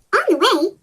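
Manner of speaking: ordinary delivery, nothing marked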